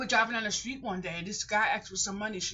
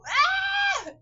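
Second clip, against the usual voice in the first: sudden high yelp, much louder; 10 dB louder than usual. Real, not acted. Talking to YouTube, a sudden high loud yelp. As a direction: let out a startled high yelp.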